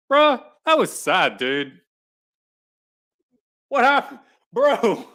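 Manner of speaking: high, loud shocked outburst. Real, not acted. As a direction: blurt out in disbelief. Shocked at the sad passage.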